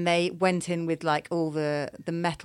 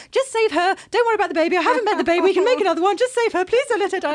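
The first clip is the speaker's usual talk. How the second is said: high, loud, panicked pleading; husband's desperate plea; 13 semitones higher, 8 dB louder, faster than usual. Retold or imitated. Husband begging doctors in panic, high frantic plea. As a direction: beg them frantically to save her. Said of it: "he was just, like, saying".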